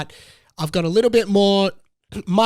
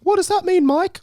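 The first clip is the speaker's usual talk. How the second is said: mock curious listener, pitched higher; imitating a listener; 12 semitones higher, 4 dB louder than usual. Host pretending to be his listener asking, lightly higher. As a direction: play a puzzled fan asking the host. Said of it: "That was me pretending to be you there".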